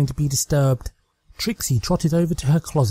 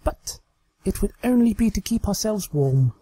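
higher, hesitant and coy; coy Trixie; 9 semitones higher than usual. Acted. Trixie hinting to her doll, higher and coyly hesitant. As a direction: trail off shyly, hinting.

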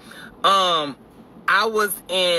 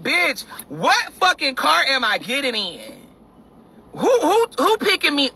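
exasperated; 9 semitones higher than usual. Retold or imitated. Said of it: "I'm like".

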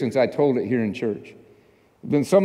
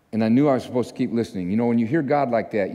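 ordinary talk

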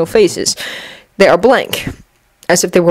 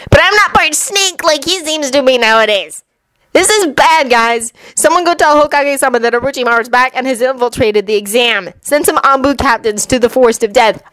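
higher-pitched insistent voice; urgent Anko; 6 semitones higher than usual. Acted. Anko warns the examiners and gives orders, higher and urgent.

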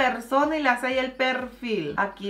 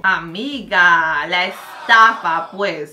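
lower, slow, loud drawn-out scoff; scoffing disbelief; 6 semitones lower, 6 dB louder, much slower than usual. Real, not acted. Mocking a three-thousand-dollar necklace, slow scoff. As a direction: call out the scam.

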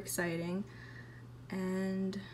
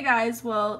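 ordinary talk

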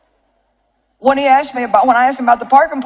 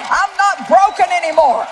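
raised, fervent preaching, slower in places; preaching at full force; 6 semitones higher than usual. Acted. Preacher proclaims God's freedom to the congregation, fervent and raised. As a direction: lift the room with conviction.

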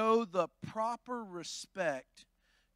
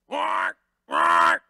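barked loud croak; loud frog croak imitation; 13 dB louder than usual. Showing the congregation a frog's croak, barked loud. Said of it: "He goes".

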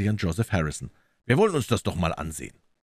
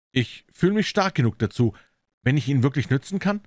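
lightly voiced client, somewhat higher; story figure of Phelps; 7 semitones higher than usual. Client offers the detective his help, lightly voiced. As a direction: offer help in a slightly raised character voice.

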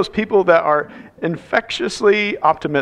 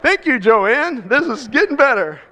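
sarcastic; 6 semitones higher than usual. Retold or imitated. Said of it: "I'm like".

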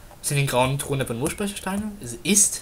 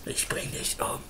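whispered threat; 10 dB quieter, slower than usual. Acted. Joking threat to friend.

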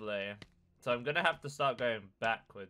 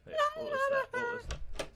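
startled squeak. Real, not acted. Startled by game.

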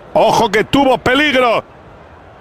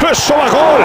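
excited goal call; 7 semitones higher than usual. Acted. Goal scored during match commentary.

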